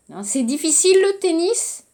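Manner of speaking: fast, tennis rising high; quick rising question. Shown as a demonstration. Teacher repeating the question tune for students.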